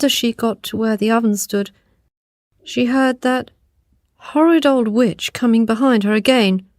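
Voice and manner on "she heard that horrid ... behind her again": usual speaking voice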